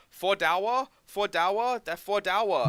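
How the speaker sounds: loud, high haggling in broken English; dad's mock broken English. Dad haggling with old lady at garage sale, loud and high. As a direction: bark the price like an embarrassing dad. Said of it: "he starts going".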